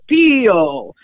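Italian monk stressing his name; Padre Pio. Retold or imitated. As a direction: sing out the name in an exaggerated Italian accent. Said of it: "such a thick Italian accent".

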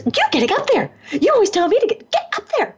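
high-pitched, urgent nagging; acted-out bossy insistence; insistent order. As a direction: push a reluctant friend, voice way up. Student insisting she go on stage.

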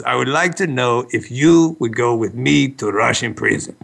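lightly put-on Russian accent; Russian accent impression. Host imitating his Russian friend's request, mild Russian accent. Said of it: "I'm doing an impression of you".

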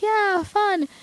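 high, light little cheer; playful high-pitched mini cheer; mock park-goers. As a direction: imitate happy visitors having fun. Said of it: "people, like, going".

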